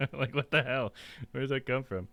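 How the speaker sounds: ordinary delivery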